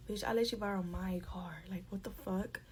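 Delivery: hushed, breathy retold whisper of disbelief; exasperated whisper. Telling viewers what she thought of the friend, hushed whisper. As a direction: whisper it like thinking it under your breath.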